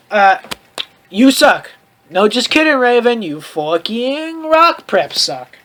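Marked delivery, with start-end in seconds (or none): none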